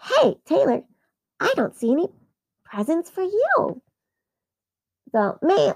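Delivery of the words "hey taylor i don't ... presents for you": put-on higher, teasing kid voice; a touch of taunting little sister. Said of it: "she's like".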